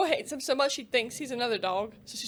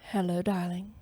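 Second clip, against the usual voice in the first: playful smooth flirt; low sultry darling voice; 6 semitones lower than usual. Shown as a demonstration. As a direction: purr it low to tease your friend. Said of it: "you want me to sound more like".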